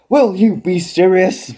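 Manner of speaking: a put-upon broadcaster begging his partner; imitating Gorilla. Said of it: "You know".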